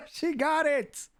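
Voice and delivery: high, laughing celebration; excited cheer. Real, not acted. Host celebrates the first code winner, laughing excitedly.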